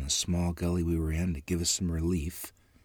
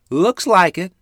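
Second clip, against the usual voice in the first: high and loud answer; a short, bright reply to her son; mother's voice; 12 semitones higher, 12 dB louder than usual. A narrator's reading. Mother answers her boy about the dust, high and loud.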